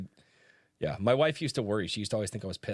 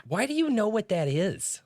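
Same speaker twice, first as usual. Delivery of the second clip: lower, measured storytelling read; dramatic reading; 4 semitones lower than usual. Acted. Reading the author's first page aloud as a dramatic narrator. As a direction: read it like an epic opening.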